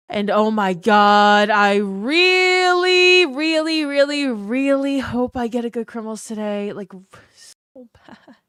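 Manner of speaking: dramatic wishful whine; pleading hope. Real, not acted. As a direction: stretch every really, begging for loot.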